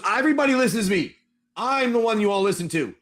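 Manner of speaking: high-pitched, self-important protest; indignant insistence. Acted. Ghoul priest objects to being disobeyed, indignant.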